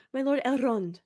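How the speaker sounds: "My lord Elrond" with a trilled R in "lord" and "Elrond"; mock rolled R. Vlogger mocking the show's actors, trilling the R in "Elrond". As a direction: roll the R in "Elrond" heavily like the actors. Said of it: "Quit rolling your R's".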